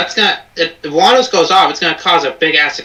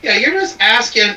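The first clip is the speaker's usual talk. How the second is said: ordinary talk, nothing marked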